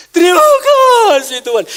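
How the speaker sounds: high, full-throated cry of thanks; loud shout. Acted. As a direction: shout thanks as the healed leper. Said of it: "dia teriak dengan suara nyaring".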